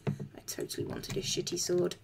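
soft whispered sarcasm; sarcastic whisper. Acted. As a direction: breathe it out sarcastically. Sarcastic aside after refusing.

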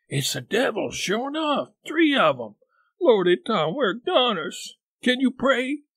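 put-on high, frightened boyish voice; terrified, pitch leaping up; shuddering fear. A narrator's reading. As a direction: panic in a high pitch, sure you're doomed. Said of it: "whispered with a shudder".

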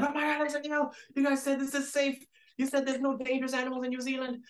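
high, loud panicked protest; frantic, high-pitched mock panic; retold terrified scream. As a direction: re-enact screaming in fright at the crew. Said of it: "So I scream".

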